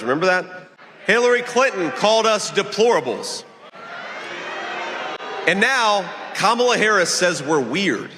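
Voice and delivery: high, projected stump-speech delivery; rally speech. Real, not acted. Candidate attacks the opposing party before a crowd, rally speech. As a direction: address the crowd in measured, emphatic phrases.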